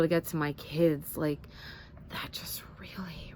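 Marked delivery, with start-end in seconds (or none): 2.1-3.3 hushed whisper. Real, not acted. Mom confides her panic to viewers, whispered. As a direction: breathe the fear out in a whisper.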